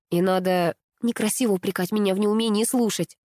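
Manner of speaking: plain reading voice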